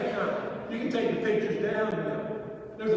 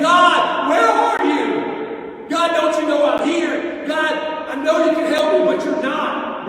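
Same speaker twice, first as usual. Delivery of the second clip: loud, pleading cry to God, high on "where are you"; desperate prayer; 4 semitones higher, 6 dB louder than usual. Retold or imitated. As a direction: cry out to God as if drowning in the storm. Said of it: "and I'm praying".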